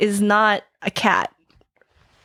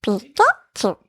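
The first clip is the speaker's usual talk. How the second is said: squeaky Pikachu cry; slower than usual. Shown as a demonstration. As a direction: call out like a tiny electric mouse. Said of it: "which one was that one".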